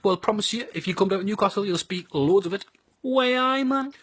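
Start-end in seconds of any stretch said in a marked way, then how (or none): 3.0-3.9 high-pitched, hammed-up Geordie catchphrase; Geordie accent. Shown as a demonstration. Showing visitors what they'll speak, Geordie accent. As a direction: lift it high in showy Geordie. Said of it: "Everyone loves the Geordie accent".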